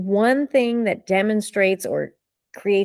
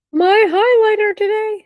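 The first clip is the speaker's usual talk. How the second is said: much higher, louder, a squeaky excited reveal; playful high voice; 14 semitones higher, 5 dB louder than usual. Acted. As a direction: show off the prop in a cartoonish squeal.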